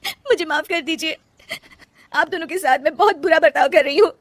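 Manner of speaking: wife tearfully apologising to her husband and mother-in-law; tearful apology. Acted. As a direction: beg their forgiveness.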